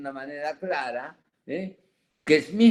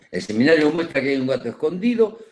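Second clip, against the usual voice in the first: louder and fast, driving the point home; emphatic declaration; 3 semitones higher, 8 dB louder, much faster than usual. Real, not acted. Lecturer to students, revealing the hidden catch, loud and quick. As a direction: announce it to the whole room, quick and forceful.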